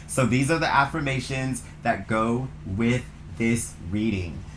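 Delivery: ordinary delivery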